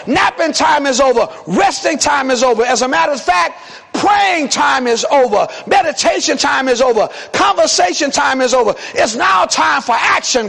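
rising preacher's call, shouting get up; preaching at full force. Acted. Preacher rousing a sleepy church, shouting.

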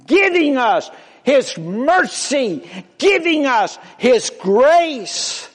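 high, loud preaching call; rising preaching. Real, not acted. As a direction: lift the voice and call out to the congregation. Preaching climax.

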